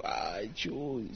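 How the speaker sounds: soft, low, woeful plea; hushed, hammed-up sorrow; mock sad. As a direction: imitate a downcast man sighing for prayers. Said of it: "bien triste y me dijo".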